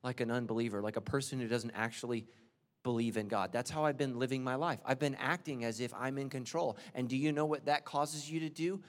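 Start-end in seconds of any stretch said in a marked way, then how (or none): none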